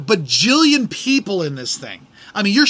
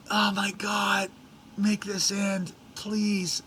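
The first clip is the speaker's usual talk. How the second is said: much softer, worn-out groan of a plea; exasperated plea; 10 dB quieter, much slower than usual. Real, not acted. Fed-up tourist begging the ordeal to end, drained and soft. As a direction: beg it to be over, drained. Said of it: "pleading aside, exasperated".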